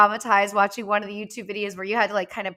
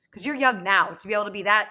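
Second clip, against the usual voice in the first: ordinary talk, nothing marked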